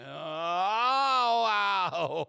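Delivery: long, loud, high "oh, wow"; drawn-out cry of pain; delayed pain howl. Acted. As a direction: howl as pain suddenly hits. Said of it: "the pain doesn't register right away".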